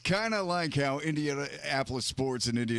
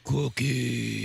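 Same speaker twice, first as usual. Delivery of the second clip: louder distorted monster roar; Cookie Monster voice; 3 dB louder than usual. Acted. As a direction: rumble the word like Cookie Monster. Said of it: "Let's have a cookie off".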